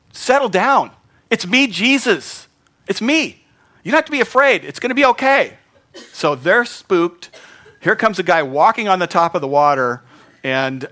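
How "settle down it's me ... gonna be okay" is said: high, loud reassuring call over the storm; Jesus calming them. Acted. Jesus calling to scared disciples on the water, high and loud. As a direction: call out comfort across the water.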